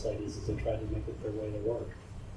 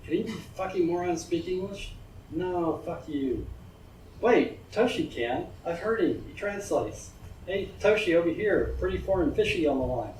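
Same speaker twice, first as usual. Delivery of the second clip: lightly voiced rough young thug, higher and excited; a hint of a cocky gang youth; gawking thug; 6 semitones higher than usual. A narrator's reading. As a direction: play the leering street punk. Thugs calling their friend over.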